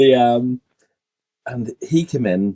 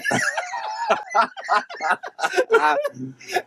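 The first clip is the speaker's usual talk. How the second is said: shrieking laugh, nearly crying; high helpless laugh. Real, not acted. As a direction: laugh until it hurts. Laughing at the slap game.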